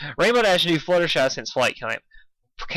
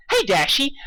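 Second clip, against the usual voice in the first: put-on high, bubbly pony girl; chirpy pitch way up; Pinkie's voice; 12 semitones higher than usual. A narrator's reading. Narrator voicing Pinkie calling out to Dashy, high.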